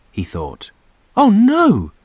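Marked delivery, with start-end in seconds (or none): 1.2-1.9 high, loud alarmed gasp. A narrator's reading. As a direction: catch your breath in dismay. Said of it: "gasped Chip".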